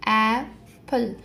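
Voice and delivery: Apple drawn out slowly as ای-پل, two clear beats; slow syllable clapping. Shown as a demonstration. Teacher to parents, showing the clapping as ای-پل, slow. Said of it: "clap here two times".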